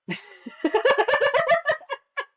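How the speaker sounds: shrieking giggle fit; high squealing laugh. Real, not acted. Laughs at baptism fetish.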